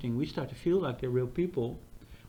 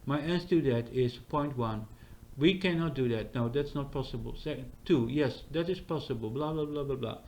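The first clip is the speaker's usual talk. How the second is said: lower, even, mechanical chatbot drone; mock robot assistant; 3 semitones lower than usual. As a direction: recite it like a stiff old computer. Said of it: "That's not how Chat G P T talks".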